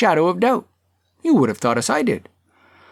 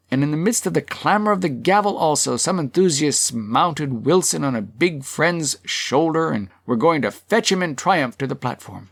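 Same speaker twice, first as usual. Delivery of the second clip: usual speaking voice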